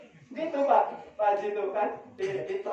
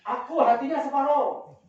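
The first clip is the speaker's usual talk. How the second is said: louder, higher mock yell; acted-out shout from across the fence; retold shout; 5 semitones higher, 5 dB louder than usual. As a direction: call out like someone claiming a share. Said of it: "Terus ada yang teriak".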